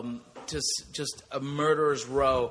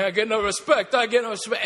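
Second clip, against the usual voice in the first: higher, louder, a hint of Rodney's pleading catchphrase; Rodney Dangerfield line; 7 semitones higher, 5 dB louder, faster than usual. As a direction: punch it up like a comic's complaint. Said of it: "his jokes were hilarious".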